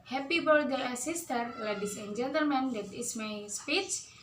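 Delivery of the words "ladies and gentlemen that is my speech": ordinary talk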